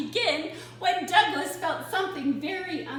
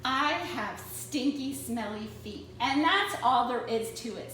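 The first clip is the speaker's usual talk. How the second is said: usual speaking voice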